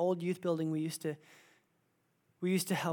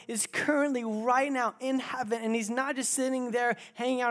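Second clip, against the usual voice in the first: preaching at full force; 7 semitones higher, 7 dB louder than usual. Acted. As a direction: lift the room with it.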